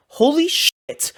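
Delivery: hammed-up eager outburst; excited Putin reaction. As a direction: play an over-eager Putin bursting out loud. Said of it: "he's gonna be like".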